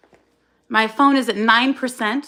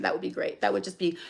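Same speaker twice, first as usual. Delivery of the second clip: whispered aside; 3 semitones lower, 8 dB quieter than usual. Real, not acted. Bitter aside after rant.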